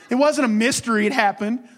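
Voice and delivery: usual speaking voice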